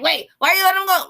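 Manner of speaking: high, fast, puzzled mother voice; mom's baffled question. Retold or imitated. Mom asks why she let the kids go, high and baffled. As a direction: mimic mom demanding why the kids can go. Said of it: "She's like".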